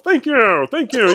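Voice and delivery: high, puppet frog voice; chirpy frog puppet thanks; Kermit the Frog. Retold or imitated. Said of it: "In the words of Kermit the Frog".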